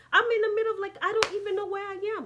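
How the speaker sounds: high, loud burst of alarm; alarmed protest. Retold or imitated. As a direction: protest loudly, pitch jumping up in disbelief. Protesting being left somewhere unknown.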